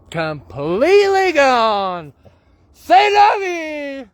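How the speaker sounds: loud, high, long drawn-out cry; comic exasperated wail. Real, not acted. Host giving up on a forgotten introduction, loud drawn-out wail. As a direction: wail it slowly at full voice.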